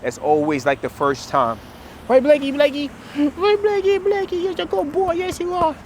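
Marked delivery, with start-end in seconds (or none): 2.1-5.7 high dog praise. Real, not acted. Trainer cooing praise to Blakey, very high baby talk. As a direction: pitch way up like cooing to a puppy.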